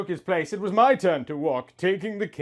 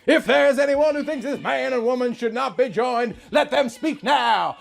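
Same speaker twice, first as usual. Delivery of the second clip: preacher asking for objections, loud and high; 9 semitones higher, 4 dB louder than usual. Acted. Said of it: "the preacher spoke again".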